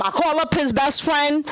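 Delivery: ordinary delivery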